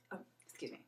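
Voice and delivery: hushed apology; whispered aside. Real, not acted. Apologizing to viewers for swearing, whispered. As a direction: drop to a sheepish whisper.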